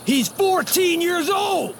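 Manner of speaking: put-on high, urgent protest about the boy; pleading outrage over a child's danger; heated protest. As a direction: argue hard for the kid's safety. Heated argument over a kid.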